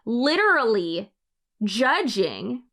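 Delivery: "literally" then "judging" set apart by a pause; mocking emphasis. Reading a commenter's capitals aloud, mockingly emphatic. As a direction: punch "judging" as its own word.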